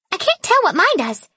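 very high squeaky helium voice. A narrator's reading. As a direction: talk like you just sucked helium. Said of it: "Her voice sounded like she'd sucked in helium".

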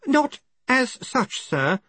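slow, high, careful reply; strained patience. Acted. Assistant correcting his Arch-Chancellor, well-honed patience. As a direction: spell it out with practised patience. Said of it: "with well-honed patience".

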